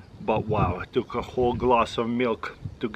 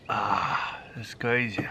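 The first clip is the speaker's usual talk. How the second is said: ordinary talk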